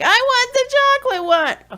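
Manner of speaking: put-on high, whining child voice; whiny child. Child whining at parent for the chocolate one. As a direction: play the whining kid asking for chocolate.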